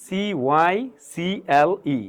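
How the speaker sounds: slow, letter by letter CY CLE; each letter of CY CLE named separately; spelling out. Shown as a demonstration. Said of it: "বানান করতে হবে এভাবে".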